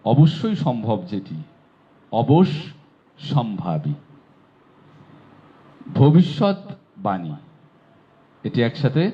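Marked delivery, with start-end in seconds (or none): 2.1-3.9 অবশ্যম্ভাবী drawn out, doubled শ্শ held; slow careful pronunciation. Shown as a demonstration. 6.0-7.3 ভবিষ্যৎবাণী said slowly, doubled ষ্য held; slow careful pronunciation. Shown as a demonstration.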